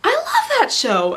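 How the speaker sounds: high, drawn-out gush; imitated excitement. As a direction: imitate a friend squealing over a show. Retold friend's delighted discovery.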